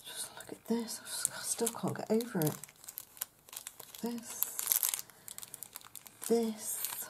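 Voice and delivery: soft, hushed, amazed whisper; awed whisper. Real, not acted. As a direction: whisper in wonder.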